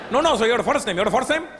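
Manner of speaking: high, flustered Indian agent voice; loud, frantic Indian accent, at wit's end; exasperated Indian accent. Retold or imitated. Agent loses patience with the caller in a high Indian accent.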